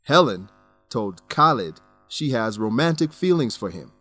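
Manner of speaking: ordinary talk, nothing marked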